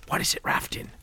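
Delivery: soft, voiceless whisper; hushed, curt whispered question; terse whisper. A narrator's reading. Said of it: "Colin whispered tersely".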